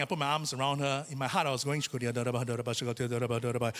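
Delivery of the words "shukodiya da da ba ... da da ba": hushed, rhythmic tongues babble; praying in tongues. Retold or imitated. Preacher retells quietly praying in tongues beside his crying mom. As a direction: murmur tongues under the breath. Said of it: "I was praying in tongues".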